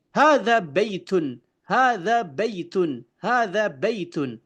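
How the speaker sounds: slow careful "Hadza baitun"; Arabic model reading. Shown as a demonstration. Teacher models correct Arabic for the class, careful. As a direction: recite "Hadza baitun" for students. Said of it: "Nah, seperti itu".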